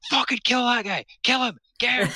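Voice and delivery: high, loud bloodthirsty cheering; mock excited yell. Wife urging him on while he plays, shrieking high. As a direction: egg him on to kill like a gamer gone feral. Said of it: "she's like".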